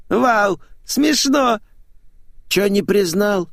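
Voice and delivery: very high theatrical voice; flamboyant showman, teasing. Acted. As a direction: tease him high and campy. Flamboyant man teases old acquaintance.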